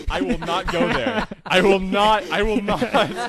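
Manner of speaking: loud, high, drawn-out refusal; emphatic refusal. Real, not acted. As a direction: dig your heels in, slow and loud.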